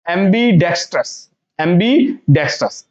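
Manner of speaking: slow, careful "ambidextrous"; pronunciation model. Shown as a demonstration. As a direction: say "ambidextrous" clearly as am-bi-DEX-trus. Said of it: "इसको आप कैसे pronounce करेंगे".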